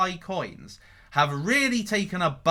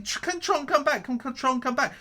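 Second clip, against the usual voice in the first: higher pitched, imitating anxious hopefuls; mock worried investors; 8 semitones higher than usual. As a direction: parrot the hopeful crowd, pitch up. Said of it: "everybody's saying".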